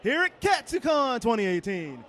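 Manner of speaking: big hyped announcer intro. Acted. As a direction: boom it out like a show host. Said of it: "Don't ask why I sound like an announcer".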